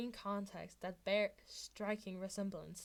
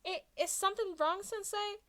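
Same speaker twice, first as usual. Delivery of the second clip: high, sweet fake-innocent question; mock innocence; 11 semitones higher, 6 dB louder than usual. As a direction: play dumb to the teacher. Izuku fake innocent to Aizawa.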